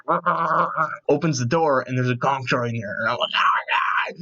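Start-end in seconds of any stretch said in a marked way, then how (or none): 0.1-0.9 high droid babble "rah rah"; imitated doorbell droid. Imitating the doorbell droid from Return of the Jedi, high babble. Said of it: "it comes out and it's like". 3.4-4.2 strained, squealed fan freak-out; mock fan scream. Fan spotting a gonk droid, strained mock scream.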